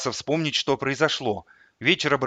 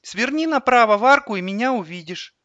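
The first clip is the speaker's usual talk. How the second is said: put-on high-pitched little boy giving directions; child voice; 11 semitones higher, 5 dB louder than usual. A narrator's reading. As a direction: guide mum in a small boy's voice. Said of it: "Он говорил в телефон детским голосом". (детский голос)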